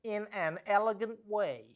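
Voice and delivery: mock-elegant put-on voice; imitating the duchess. Presenter quotes the duchess's odd cooking phrase, mocking imitation. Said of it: "as she oddly puts it".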